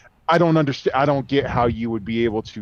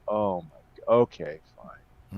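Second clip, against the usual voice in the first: low, slow, quiet dawning recognition; resigned realization; 6 semitones lower, 9 dB quieter, slower than usual. Retold or imitated. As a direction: let it sink in, deadpan. Realizing who the wrestler is.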